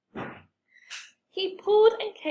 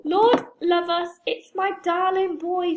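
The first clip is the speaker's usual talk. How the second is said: relieved mother; 4 semitones higher, 6 dB louder than usual. A narrator's reading. Mother relieved to find Tom.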